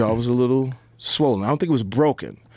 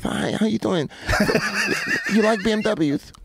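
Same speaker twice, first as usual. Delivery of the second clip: high, light come-on voice with a giggle; playful pickup line in a raised squeaky voice; Mike Tyson impression; 9 semitones higher than usual. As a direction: hit on the girls like a giddy champ. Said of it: "he be like".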